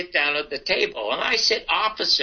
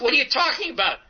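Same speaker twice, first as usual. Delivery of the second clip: higher-pitched retold protest; incredulous objection; 7 semitones higher than usual. Young comic objecting to an absurd joke premise, incredulous. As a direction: imitate a baffled objection, rising in pitch.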